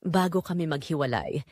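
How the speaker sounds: ordinary delivery, nothing marked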